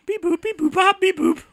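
high beeping robot; toy robot voice. Pretend. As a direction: chirp like a little toy robot. Imitating the yellow stalker robot.

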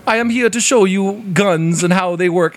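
fake Russian accent. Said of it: "started doing a fake Russian accent".

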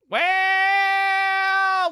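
exaggerated high drawn-out well. Sponsor read opener.

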